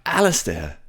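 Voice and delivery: loud cry across the distance; calling out. Acted. Ivan and Juno cry out after Alistair, loud call. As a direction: shout his name after him.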